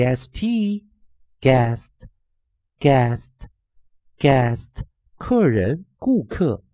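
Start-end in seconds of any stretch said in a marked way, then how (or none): 2.8-4.8 "guest" as "gest", silent u and clear final t; word pronunciation demo. Shown as a demonstration. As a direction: model "guest" slowly, one isolated word at a time. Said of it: "那我们来看三个生词". (领读"guest"，u不发音，逐个停顿)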